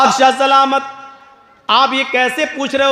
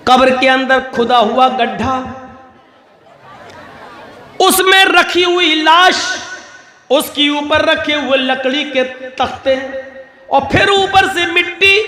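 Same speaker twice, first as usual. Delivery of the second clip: preaching; 2 semitones higher than usual. Acted. Preacher tells his brothers about the dark grave, impassioned.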